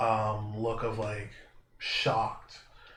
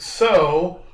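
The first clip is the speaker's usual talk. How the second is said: loud, higher, pressing "so"; aggressive; 5 semitones higher, 9 dB louder than usual. Acted. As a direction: confront them with a loud opener. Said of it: "getting very aggressive".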